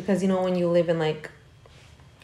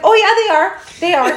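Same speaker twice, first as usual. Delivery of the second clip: high-pitched mock insistence; insistent retold reply; 10 semitones higher, 4 dB louder than usual. Friend scaring viewers after the alien movie, mock insistence. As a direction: imitate someone insisting, pitched way up.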